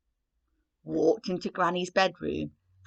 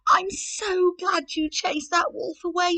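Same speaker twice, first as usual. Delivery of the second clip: put-on high relieved little girl; 10 semitones higher, 4 dB louder than usual. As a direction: say it gratefully, high. Red thanks Granny.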